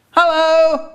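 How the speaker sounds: cartoon skull greeting; funny squeaky voice. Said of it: "a funny voice going".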